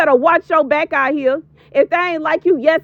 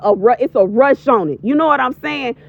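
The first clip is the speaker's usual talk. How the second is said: usual speaking voice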